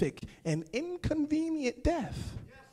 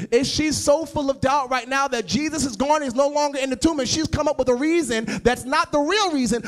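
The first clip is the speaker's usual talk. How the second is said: loud, high, rising sermon cry; full-throated preacher's climax; preaching at full force; 5 semitones higher, 6 dB louder than usual. Acted. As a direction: preach it to the back row.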